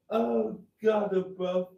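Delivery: high breathless giggling between words; trying to recover from laughing; still laughing. Real, not acted. Apologising to Ian while still cracking up with laughter.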